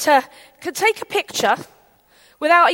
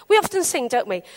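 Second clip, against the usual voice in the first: usual speaking voice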